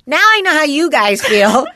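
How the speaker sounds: playful imitation of son realizing; mock exasperated kid. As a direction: deliver it like a kid finally getting it. Son's line retold, amused.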